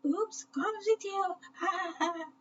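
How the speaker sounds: very high, squeaky laughing monkey; mock the clumsy tail with a cheeky cackle; high cartoon monkey teasing. A narrator's reading. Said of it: "A monkey laughed loudly".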